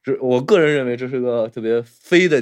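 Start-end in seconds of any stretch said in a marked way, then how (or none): none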